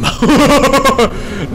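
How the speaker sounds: high-pitched cackling burst of laughter; loud high laugh. Real, not acted. Laughing at game mishap.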